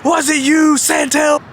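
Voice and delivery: high mock interrogation; Jeff Jarrett. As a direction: imitate a suspicious boss sniffing out the culprit. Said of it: "He looks at me, he goes".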